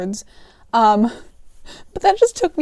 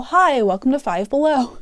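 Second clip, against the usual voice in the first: ordinary talk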